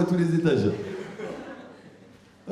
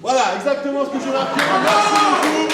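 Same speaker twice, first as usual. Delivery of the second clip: high, loud triumphant cry; triumphant reveal; 8 semitones higher, 4 dB louder than usual. Acted. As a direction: crow over the trick working.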